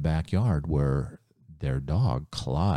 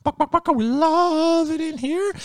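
very high, louder chicken voice with clucks; clucking chickens; 4 dB louder than usual. Acted. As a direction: cluck like a happy hen. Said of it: "The chickens are like".

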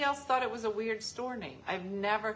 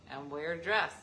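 ordinary talk, nothing marked

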